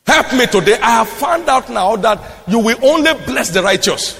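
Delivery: high, insistent pulpit exhortation; urgent preaching. Real, not acted. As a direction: press the congregation to repent. Urges congregation to repent.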